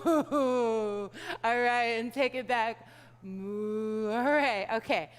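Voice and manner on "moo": low moo; cow moo. Shown as a demonstration. Teacher takes the cows back, low moo. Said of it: "Cows are slow".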